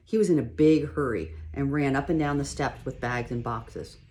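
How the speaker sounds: ordinary delivery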